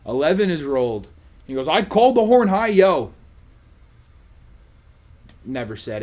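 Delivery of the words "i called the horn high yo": high, loud mock insistence; loud protest. Gambler disputes the dealer's placement after the roll, loud protest. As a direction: imitate a gambler loudly claiming his bet.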